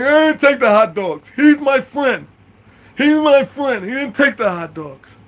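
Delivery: loud, high put-on voice, drawn out; mock a pleading outburst in a high voice; friend's wailing protest.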